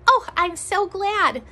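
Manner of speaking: happy teacher greeting; glad. Real, not acted. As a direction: welcome them back cheerfully. Welcoming children back.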